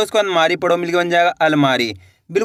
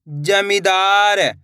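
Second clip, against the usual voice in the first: slow blending; much slower than usual. Shown as a demonstration. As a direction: draw the word out slowly, syllable by syllable. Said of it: "इसको इस तरीके से पढ़ लेना है". (तकलीफ को बहुत धीरे खींचकर बोलें)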